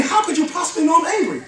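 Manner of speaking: high, lightly acted amazement; amazed question. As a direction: lift the voice high in mild wonder. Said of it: "She's saying".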